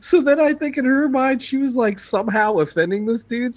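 high, amused storytelling. Real, not acted. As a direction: pitch jumps high mid-story. Amused high-pitched retelling.

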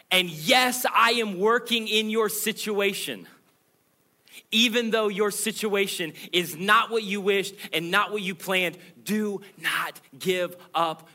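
high and loud, slowing right down at do not give up; loud urging. Acted. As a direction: plead with full force, then ease off. Preacher voicing God, building.

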